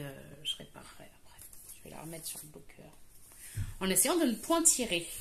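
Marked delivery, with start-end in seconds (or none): 0.0-2.9 whisper. Real, not acted. Trailing aside.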